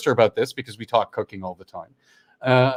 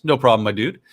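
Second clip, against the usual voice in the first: ordinary talk, nothing marked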